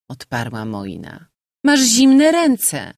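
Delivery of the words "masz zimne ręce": very slow, much higher and louder whine; pouting complaint. A narrator's reading. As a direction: drag out the complaint sulkily. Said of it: "z dąsem".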